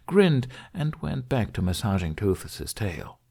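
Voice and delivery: plain reading voice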